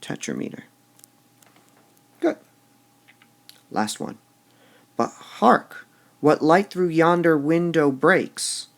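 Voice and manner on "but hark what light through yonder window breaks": reads the line with big emphasis; lingering on the beats; poetry reading. Shown as a demonstration. Teacher reads Shakespeare aloud to class, with strong emphasis. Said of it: "All right, this time".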